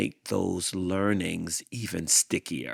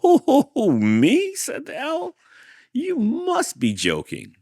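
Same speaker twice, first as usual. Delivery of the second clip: mock surprise; 8 dB louder, slower than usual. Owl mocks Hank's offer.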